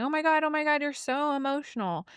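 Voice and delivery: mock someone fussing over another's tears; put-on, high dismissive whine; mock exasperation. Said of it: "when the woman's crying".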